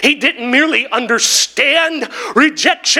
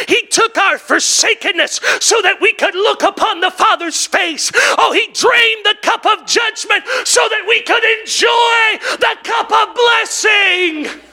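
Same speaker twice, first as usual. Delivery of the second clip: high shouted preaching climax; fiery sermon climax; preaching at full force; 8 semitones higher than usual. Acted. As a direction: lift it to a shouting peak.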